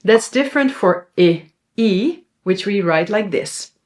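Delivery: ordinary talk, nothing marked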